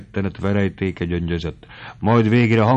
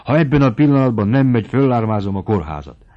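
loud, fast, high threat to call for help; angry threat; 4 semitones higher, 7 dB louder than usual. Acted. Mari threatens Noszti to raise the alarm, loud and fast.

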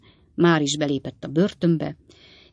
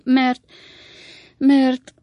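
stalling, higher and unsure; hesitating; 8 semitones higher, 6 dB louder than usual. A narrator's reading. Sam stalls before his master, hesitant. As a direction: stumble over the start. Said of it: "mondta Sam habozva".

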